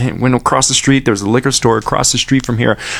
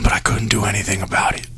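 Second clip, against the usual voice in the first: whisper; 6 dB quieter than usual. Real, not acted. Host admitting helplessness to listeners, whispered. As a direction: drop to a hushed whisper.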